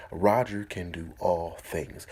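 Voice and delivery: Roger can do all things, slow, Roger stressed; stress on the name. Shown as a demonstration. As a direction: land on Roger slowly. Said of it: "Put your name in that purse".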